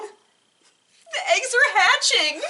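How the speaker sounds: high, loud, slow shout with a laugh; laughing announcement. Acted. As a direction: burst out with the news, laughing. Piggie announces hatching.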